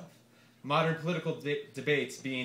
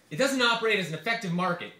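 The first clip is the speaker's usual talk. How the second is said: raised pitch, louder, lecturing candidate; Santorum voice; 6 semitones higher, 6 dB louder than usual. Acted. Senator lecturing a reporter after the debate, raised and louder. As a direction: play the smug senator. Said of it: "This is gonna require a lot of voices".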